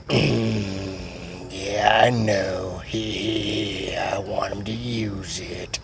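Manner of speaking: low, soft, dragged-out creep voice; creepy slow character. Retold or imitated. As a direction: drawl it quietly with a creepy chuckle.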